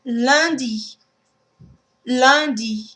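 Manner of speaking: "lundi" with nasal un, no N sounded; French nasal un. Shown as a demonstration. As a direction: say "lundi" through the nose, leh-dee. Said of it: "Let's practice a few words for that one".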